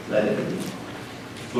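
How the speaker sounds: breathy whispered let it be. Real, not acted. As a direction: breathe the words out almost silently. Hushed closing phrase.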